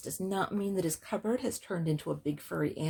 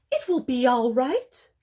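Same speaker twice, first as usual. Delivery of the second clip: high, loud storybook comfort; reassuring animal friends; 7 semitones higher, 7 dB louder than usual. Acted. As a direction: reassure in a lifted little-animal voice. Friends comfort Bear with hug.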